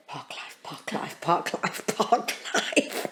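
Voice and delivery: whispered chant, holding back tears; breathy repeated whisper to stay calm; whispered to herself. Retold or imitated. Holding back tears at the experiment, whispered chant. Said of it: "you're going".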